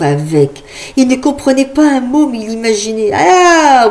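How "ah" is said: high, loud hyped-up showman calling to a crowd; loud, high crowd-pumping host; rowdy party host. Acted. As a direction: whip up the crowd like a fairground host. Imagined loud party host shouting.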